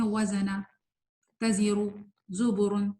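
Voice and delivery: short, casual ز with no buzz in وزنا; wrong reading. Shown as a demonstration. Teacher showing students the mistake, quick unbuzzed ز. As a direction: rush through تزیرو and زبرن without holding the ز. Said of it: "جب ہم غلط پڑھتے ہیں".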